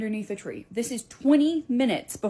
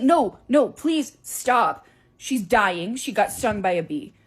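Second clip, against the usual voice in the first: louder pleading; desperate plea; 7 dB louder, slower than usual. Retold or imitated. Teacher begging the shopkeeper to stop acting, desperately. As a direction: beg her to drop the act.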